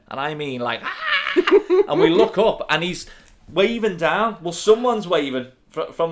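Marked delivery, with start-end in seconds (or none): 0.8-1.3 high squealing scream of a fan; imitated fan scream. Fan girls spotting their idol, high squeal. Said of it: "they all start screaming".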